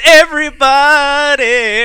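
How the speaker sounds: long, loud, high shout; big drawn-out call. Retold or imitated. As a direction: call the whole room in, slowly and loud. Ad guy's big call to everyone.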